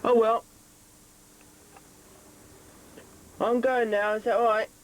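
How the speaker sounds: yelling across the room. Real, not acted. Calling through intercom to kids.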